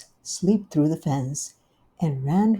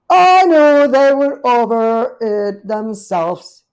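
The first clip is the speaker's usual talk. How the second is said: high, loud, slow knowing remark; drawn-out gossip voice; slow, high knowing cat voice; 6 semitones higher, 15 dB louder, slower than usual. Acted. Cousin Tabitha speaks.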